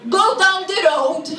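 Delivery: imitated Maltese-accented local; Maltese English. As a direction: give directions in broken Maltese English. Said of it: "a Maltese person who doesn't speak English very well".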